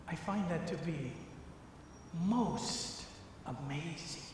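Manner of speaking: quiet wonder after the big point; hushed awe. Real, not acted. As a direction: drop to an awed hush. Awed aside after preaching.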